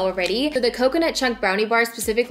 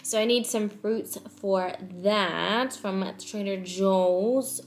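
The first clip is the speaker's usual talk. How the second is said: normal talking voice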